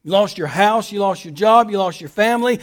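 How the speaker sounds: ordinary talk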